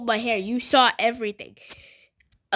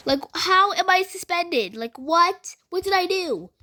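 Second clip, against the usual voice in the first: higher, pleading what did I do; baffled protest; 6 semitones higher than usual. Retold or imitated. Student pleading innocence to the principal, high and baffled. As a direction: sound honestly confused and wronged.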